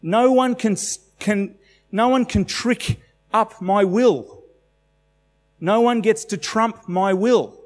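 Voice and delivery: high, slow, emphatic proclamation; voicing Jesus, bold. Preacher voicing Jesus to the congregation, high and triumphant. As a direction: declare it with unshakable confidence.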